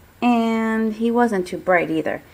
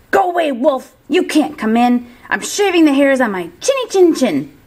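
yelling back; 5 semitones higher, 5 dB louder than usual. Retold or imitated. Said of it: "He yelled back".

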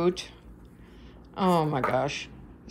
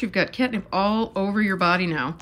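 normal talking voice

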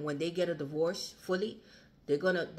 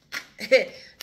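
short loud burst of laughter; 5 semitones higher, 12 dB louder than usual. Real, not acted. Reader laughing at the cards, loud. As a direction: laugh in delight.

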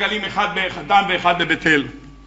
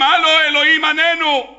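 calling out; 6 semitones higher than usual. Acted. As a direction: yell up to a god who won't answer. Idol worshippers calling their god.

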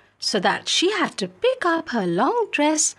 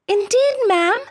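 put-on much higher, bright girl's voice; eager young maid; 10 semitones higher, 3 dB louder than usual. Filippipa character voice.

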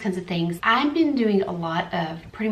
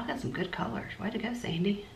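whisper; 3 semitones higher, 7 dB quieter than usual. Real, not acted. Praising herself under her breath.

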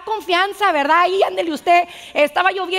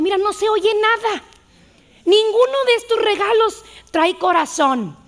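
put-on child complaining; 6 semitones higher, 3 dB louder than usual. Carlitos shakes a gift, complains.

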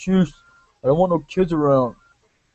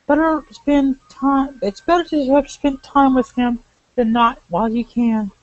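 higher, gentle pleading woman; 9 semitones higher than usual. Acted. Voices Sonya.

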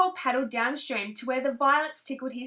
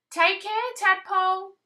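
higher, louder calling out; 6 semitones higher, 4 dB louder than usual. Acted. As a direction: call after him down the stream. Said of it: "called Mother Frog".

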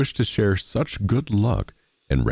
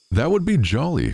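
high-pitched happy exclamation; delighted cry; 8 semitones higher than usual. A narrator's reading. Miller hears of salad in the bed, crying out. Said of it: "cried the miller".

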